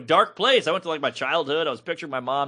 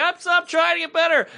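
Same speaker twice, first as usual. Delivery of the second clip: high, sheepish self-defense; meek excuse; 12 semitones higher than usual. Retold or imitated. Comedian sheepishly answering the instructor. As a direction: plead weakly to do better. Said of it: "I'm like".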